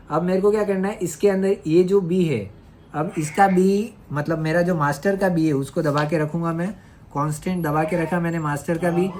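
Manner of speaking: usual speaking voice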